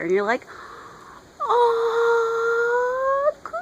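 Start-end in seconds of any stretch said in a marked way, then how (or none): 1.4-3.6 very high squealing gush; fangirl squeal. Real, not acted. Fan swooning over Clark and Diana's date, high squeal.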